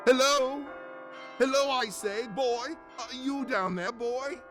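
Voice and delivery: high hail to someone below; bright-pitched, carrying shout; calling out. Acted. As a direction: call down to a boy in the street. Calling from window to boy.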